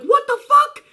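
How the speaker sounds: squeaked way up high, stunned; a sudden high-pitched what-the-fuck of disbelief; shocked disbelief. Retold or imitated. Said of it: "And I'm like".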